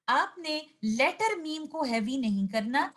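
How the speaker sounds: ordinary talk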